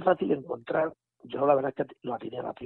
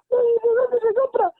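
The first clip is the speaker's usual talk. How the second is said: slow, much higher and louder, crying; tearful plea; 9 dB louder, much slower than usual. Retold or imitated. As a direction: drag it out through mock sobs. Patient overwhelmed, crying.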